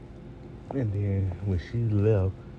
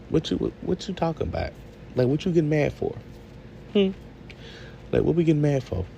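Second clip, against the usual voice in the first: higher-pitched, mildly incredulous challenge; indignant questioning; 7 semitones higher than usual. Acted. Viewer challenges Jazz over getting mad at Zoe, mildly indignant questioning. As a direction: press her for an answer, a touch indignant.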